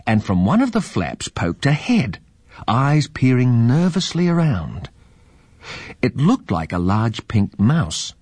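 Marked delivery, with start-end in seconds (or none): none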